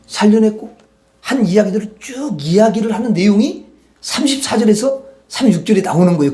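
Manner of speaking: normal talking voice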